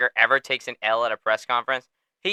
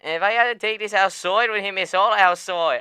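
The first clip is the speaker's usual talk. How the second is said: mock Irish Conor threat; 9 semitones higher than usual. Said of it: "And then he goes".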